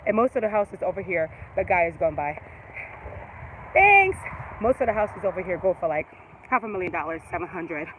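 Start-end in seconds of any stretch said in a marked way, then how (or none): 3.7-4.2 loud, high shout of thanks; calling out thanks. Real, not acted. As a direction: call a quick thanks across the street. Thanking a passing driver.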